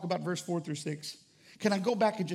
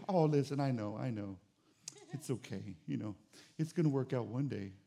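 much softer, hushed pity-party comfort; mock soothing; 7 semitones lower, 7 dB quieter than usual. Friend comforting a complainer, mock hushed soothing. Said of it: "here's what we do".

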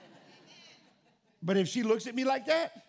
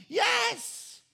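emphatic yes; 14 semitones higher, 4 dB louder than usual. Real, not acted. Admits anger at wife.

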